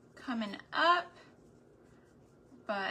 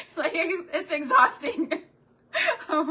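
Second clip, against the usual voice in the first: loud, high, laughing through the words; amused, voice lifted by laughter; 7 semitones higher, 8 dB louder than usual. Real, not acted. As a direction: talk through real laughter. Laughing at the movie line.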